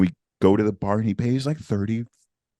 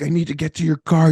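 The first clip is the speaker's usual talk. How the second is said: urgent; 9 semitones higher, 5 dB louder, faster than usual. Retold or imitated. Urgent quote in story.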